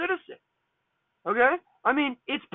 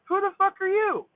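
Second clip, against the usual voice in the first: stern challenge, pitched well up; 9 semitones higher than usual. Retold or imitated. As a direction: sharply demand who this intruder is. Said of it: "very, very sternly".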